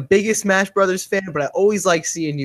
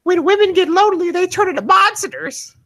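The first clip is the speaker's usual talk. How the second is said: very high, loud woman impression; mock her in a loud falsetto; exaggerated high-pitched presenter voice, slowing at the end; mimicked stage presenter; 4 dB louder than usual. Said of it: "she was like".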